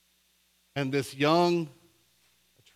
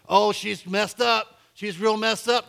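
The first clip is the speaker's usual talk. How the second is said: high, loud mock disparagement; drawn-out mock gossip, then quicker; imitated bad-mouthing; 8 semitones higher, 6 dB louder than usual. Churchgoers talking about the church together, imitated bad-mouthing. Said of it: "we talk bad about her".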